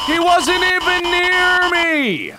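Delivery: high-pitched gamer outrage; outraged shout. Real, not acted. Outraged at being caught.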